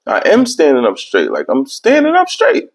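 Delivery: high baffled protest rising on straight; mock bewildered insistence; confused protest. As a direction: insist you're upright, confused. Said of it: "I'm like".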